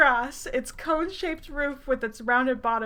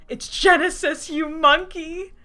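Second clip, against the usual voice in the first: loud, higher-pitched scolding, drawn out at the end; mock indignant outburst; 6 semitones higher, 5 dB louder, slower than usual. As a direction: call out a hammed-up insult. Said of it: "I heard a voice behind my head".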